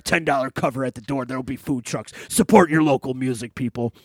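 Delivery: booming monster truck announcer. Acted. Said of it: "That's my monster truck voice".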